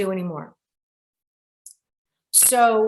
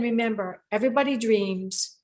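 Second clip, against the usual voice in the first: closing motto; 4 dB quieter than usual. Acted. Show sign-off motto.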